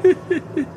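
nervous laugh. A narrator's reading. Trapped Fortunato laughs.

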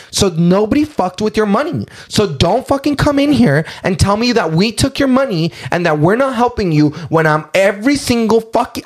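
high-pitched, worked-up retelling; retold cussing out. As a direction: replay telling off a customer. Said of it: "I literally cussed at her".

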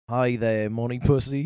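low put-on British greeting; mock British accent. His turn at British accent.

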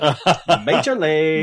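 put-on drawn-out, laughing Valley Girl majorly. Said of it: "do it in a total Valley Girl voice".

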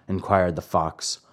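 usual speaking voice

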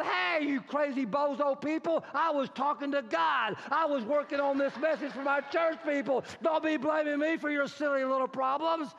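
high, hammed-up yelling; indignant retort. Driver to honking people while he was praying, indignant yell.

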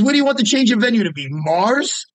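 mock-stunned clerk voice; incredulous clerk. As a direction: imitate the clerk's shocked disbelief. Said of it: "He goes".

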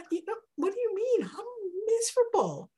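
high, pleading woman's voice; pleading Hannah. Acted. Hannah answers the priest who accused her, pleading. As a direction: plead with him, hurt and desperate.

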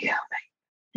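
breathless frightened whisper. Retold or imitated. Hiding from gunfire, she whispers in fear. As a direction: hush it out in terror. Retelling terror under gunfire.

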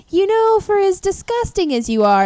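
brother-in-law voice. Retold or imitated. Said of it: "he's like".

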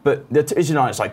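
ordinary talk

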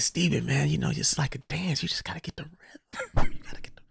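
soft, breathy whisper dropping away; whispered advice. Retold or imitated. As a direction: hush it like a secret tip. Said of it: "I said".

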